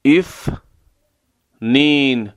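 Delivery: اثنین very slow, with a clear interdental th; slow ithnayn demo. Shown as a demonstration. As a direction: model اثنین slowly for repetition. (اثنین آهسته و کشیده)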